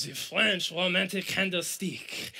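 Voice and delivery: hammed-up French accent. Preacher recalling the musical role he wanted, in a playful French accent. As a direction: romantic French candlestick accent. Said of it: "I practiced my French accent".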